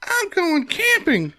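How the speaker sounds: very high-pitched, drawn-out excited camper with a cheer; excited camper's voice. Acted. Joking host voices a happy magazine camper to friends, high cartoonish cheer. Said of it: "Can I do her voice really quick".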